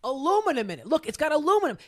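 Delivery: retold fed-up customer; exasperated protest. Acted protest to the second seller.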